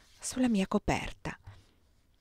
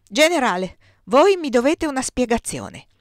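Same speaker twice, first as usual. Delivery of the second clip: loud, raised, indignant demand; hissed indignation; 4 semitones higher, 7 dB louder than usual. A narrator's reading. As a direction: demand an explanation as the outraged brother. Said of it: "sibilò la berretta da notte". (sibilato con indignazione)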